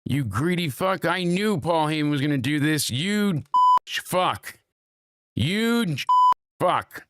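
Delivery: high, hammed-up accusing rant; mock outrage.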